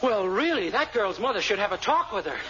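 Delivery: ordinary talk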